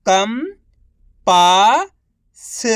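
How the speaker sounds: each syllable drawn out very slowly; slow syllable-by-syllable reading. Shown as a demonstration. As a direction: sound out सुरंग slowly as सु-रं-ग, one sound at a time. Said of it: "एक अक्षर का उच्चारण करके".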